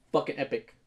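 ordinary talk

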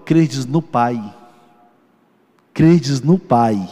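slow, emphatic scripture quote; words of Jesus. Retold or imitated. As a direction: repeat the verse slowly, pressing it harder. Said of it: "Quando Jesus fala isso, ele disse". (citando Jesus, pausado e enfático)

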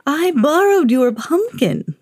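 slow, high put-on wizard voice. A narrator's reading. Wizard admits he took the pumpkin, slow sly voice. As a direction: savour it like a sly old wizard. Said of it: "said the wizard".

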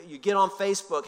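ordinary talk, nothing marked

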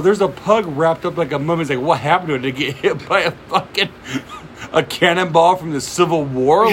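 incredulous comic rant. Retold or imitated. Retelling Bill Burr's pug joke to co-host, incredulous. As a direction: pile on the baffled questions.

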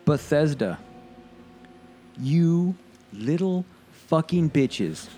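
each word dragged out with contempt; slow angry rant. Real, not acted. Ranting at Bethesda, slow contemptuous anger. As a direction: spit it out slowly at Bethesda. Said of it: "I'm fucking mad".

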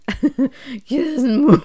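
strained, cracking laugh-broken voice; rasping strained mutant. Real, not acted. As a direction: Kismu squeezed out high and strained through a laugh. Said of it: "Why did I sound like a fucking mutant?".

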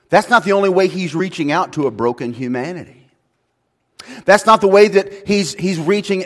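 loud, high, driving sermon voice; preaching at full force. Acted. Pastor to congregation, loud, passionate preaching. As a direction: hammer it home to the congregation.

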